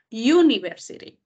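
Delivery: "University" empezando con "iu". Shown as a demonstration. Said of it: "sounds like U". ("University" con la U sonando "iu")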